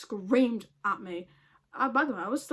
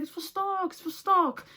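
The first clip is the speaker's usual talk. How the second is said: higher mock chef, insistent; head chef's voice; 8 semitones higher than usual. Head chef insisting the shells are for stock, higher voice. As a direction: repeat it like the fussy chef, pitched up.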